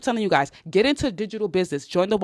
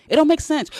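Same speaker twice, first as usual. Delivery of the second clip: louder, higher repeat; 9 semitones higher, 4 dB louder than usual. Shown as a demonstration. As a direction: punch it up a notch higher and louder.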